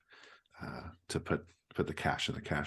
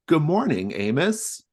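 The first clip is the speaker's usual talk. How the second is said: high, loud cheerful hello; Calamity's greeting; 11 semitones higher, 10 dB louder, slower than usual. Acted. Calamity greets Amos, high loud greeting. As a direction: sing out a bright morning greeting. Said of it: "Calamity says".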